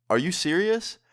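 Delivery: mock disbelief, rising high; retold shock. Boyfriend answers mom kicking him out, imitated shock. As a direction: ask it in acted shock, pitch climbing. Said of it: "I'm shocked, like, fully shocked".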